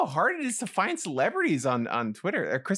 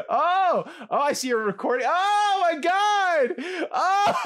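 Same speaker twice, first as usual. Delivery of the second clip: high, loud wailing panic, broken by laughs; hammed-up, drawn-out terrified cries; screaming in mock horror; 13 semitones higher, 3 dB louder, slower than usual. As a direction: shriek at a horror-movie scare. Co-host reacts to staged scare.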